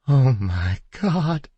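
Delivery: high, slow, breaking voice; overwhelmed with relief; wife finally recognizing her husband; overcome. A narrator's reading.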